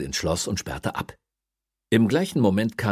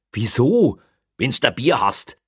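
louder, higher puzzled question; baffled retort; 5 semitones higher, 6 dB louder than usual. A narrator's reading. Policeman puzzled that beer is not enough, baffled. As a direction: question him in loud bafflement.

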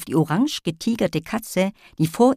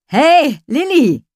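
calling out; 11 semitones higher, 8 dB louder, slower than usual. Acted. Boy calls after Lilli.

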